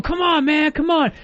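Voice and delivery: mock street fighter taunt pitched high. Homeless man taunting the group, mock high challenge. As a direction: goad him like a karate poser.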